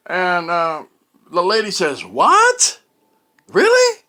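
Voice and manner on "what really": high-pitched, loud mock squeal of shock; imitating shocked disbelief. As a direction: squeak it out like the caller couldn't believe it. Caller retold reacting in disbelief.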